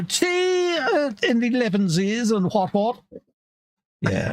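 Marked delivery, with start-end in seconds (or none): 0.0-3.0 high-pitched parody of Victorian English; mock Victorian English. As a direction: put on a high old-time English accent. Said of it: "speak like the Victorian T V shows".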